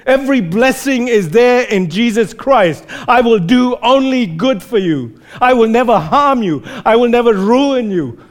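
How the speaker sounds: fervent reassuring vow; God's warm promise. Retold or imitated. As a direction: speak as a loving father vowing protection. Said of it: "his posture is like this".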